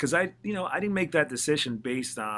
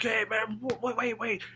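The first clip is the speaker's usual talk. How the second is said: much higher excited imitation of the label boss; mock Donnie; 11 semitones higher than usual. Label president stops the song, retold in a high mock voice. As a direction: squeak it out like a boss stopping the music. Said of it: "he's pretending he's Donnie".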